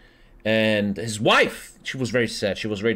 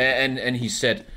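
ordinary delivery

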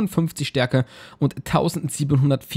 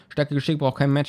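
ordinary delivery

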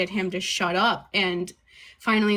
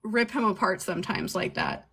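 ordinary talk

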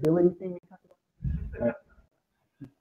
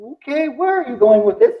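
skeptical viewer; 5 semitones higher than usual. Retold or imitated. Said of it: "You're like".

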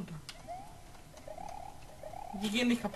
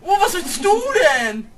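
startled outcry; 7 semitones higher, 10 dB louder than usual. Real, not acted. Startled by game enemy.

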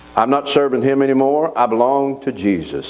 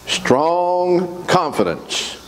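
slow, stretched and higher; drawn out; 7 semitones higher, much slower than usual. Real, not acted. Preacher stretching the verse for the congregation. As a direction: draw out each word for weight. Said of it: "preacher drawing out scripture".

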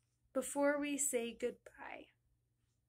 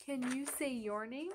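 ordinary talk, nothing marked

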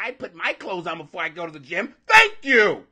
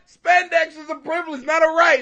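excited; 9 semitones higher, 9 dB louder than usual. Real, not acted. Excitedly repeating the funny line.

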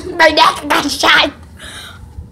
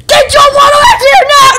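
shout; 7 semitones higher, 7 dB louder than usual. Real, not acted. Yelling at kid with gun.

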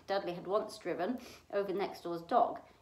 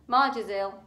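gruff uncle; 8 dB louder than usual. Acted. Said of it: "he informed Aunt Petunia".

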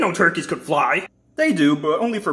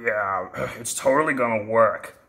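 sarcastic; 8 semitones lower than usual. Acted. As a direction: scoff along as if convinced. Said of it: "I'm being sarcastic".

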